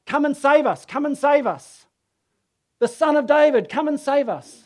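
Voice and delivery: loud, high-pitched crowd cry for rescue; pleading shouts. Retold or imitated. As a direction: call out to the king, imitating a crowd. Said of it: "what that they could have been shouting".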